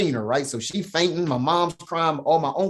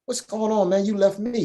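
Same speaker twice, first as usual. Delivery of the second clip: put-on pitched way up, a wounded cry of a left-behind kid; higher hurt plea of the son his father left behind; hurt boy's plea; 8 semitones higher than usual. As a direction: plead high like the abandoned son. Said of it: "I'm like".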